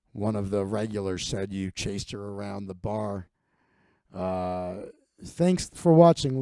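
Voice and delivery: a weary boss reading a complaint; bar owner Jerry. Retold or imitated. The owner Jerry calls Big Mike, low and droning. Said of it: "The owner, you know, calls you".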